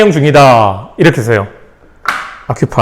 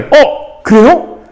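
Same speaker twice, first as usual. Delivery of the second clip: much higher, playful polite voice; mock polite surprise; 6 semitones higher than usual. Another person politely tops the 007 claim, high and playful. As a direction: perk up as if delighted to top him.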